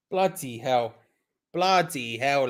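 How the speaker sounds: normal talking voice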